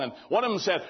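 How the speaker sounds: normal talking voice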